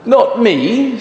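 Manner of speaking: high, bright yap of a refusal; dog, high. Acted. Dog refusing to push, in a high yap. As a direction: yip it out high. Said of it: "says the dog".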